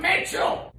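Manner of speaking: high, breathless whispered outcry; whispered cry. Acted. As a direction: hiss the name in disbelief. Exasperated call to recipe author.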